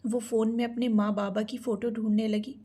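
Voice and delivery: ordinary delivery, nothing marked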